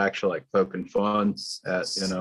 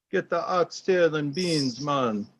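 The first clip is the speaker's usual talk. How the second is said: mock Jamaican accent; 7 semitones higher, slower than usual. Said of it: "I can see him walking down".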